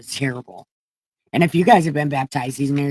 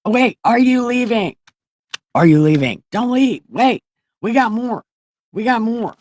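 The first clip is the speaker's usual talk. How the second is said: loud, high plea to stay; urgent raised-voice calling; 6 semitones higher, 8 dB louder than usual. Real, not acted. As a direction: call after someone walking away. Calling after someone who is leaving.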